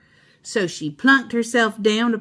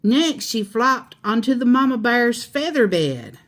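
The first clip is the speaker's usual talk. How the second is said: plain reading voice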